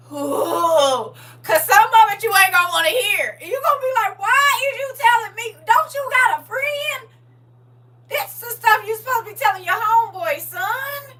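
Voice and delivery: high, loud squeal of mock dismay; playing the shocked mother, voice way up; high-pitched hammed-up horror; mock horrified mom. Mock-horrified parent hearing too much.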